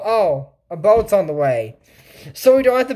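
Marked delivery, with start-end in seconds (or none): 0.0-1.8 mock relief. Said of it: "make people go".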